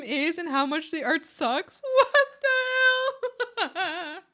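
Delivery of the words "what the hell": high, slow laugh through the words; laughing disbelief. Real, not acted. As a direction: crack up in disbelief. Shocked by the bot's insult.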